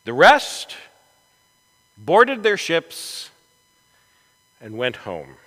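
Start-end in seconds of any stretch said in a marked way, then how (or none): none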